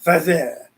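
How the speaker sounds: Pfizer said as "fah-zeh", lightly French; French-style Pfizer. Shown as a demonstration. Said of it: "Sounds kind of French".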